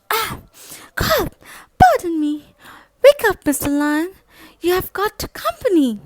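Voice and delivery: high, loud, polite squeaky plea; tiny mouse asking a giant a favour; nervous little mouse. Acted. Mouse asking the sleeping lion to teach him to roar, high and nervous.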